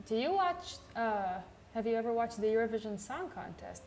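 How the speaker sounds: ordinary delivery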